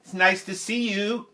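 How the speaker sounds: high, loud greeting to an intercom; talking to the speaker. Retold or imitated. Teacher answering classroom speaker like a real person, high greeting. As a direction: greet the loudspeaker as if a person, voice lifted. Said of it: "talked to the, the speaker like a real person".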